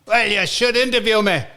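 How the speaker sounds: pushy Scottish caller impression; Scottish accent. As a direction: pitch it up in a mock Scottish accent. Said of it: "not bad accent".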